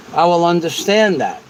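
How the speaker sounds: ordinary talk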